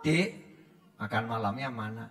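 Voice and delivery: low, very soft request; gentle husband. Acted. Husband asks wife for dinner, low and gentle. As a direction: ask her tenderly and quietly.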